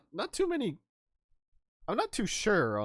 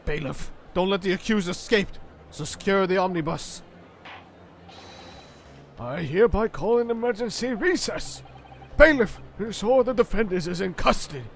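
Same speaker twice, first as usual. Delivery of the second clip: loud, high, booming orders from the bench; commanding judge; 6 semitones higher, 7 dB louder than usual. Acted. Judge ordering the bailiff amid chaos, loud and commanding. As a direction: take charge of a courtroom in uproar.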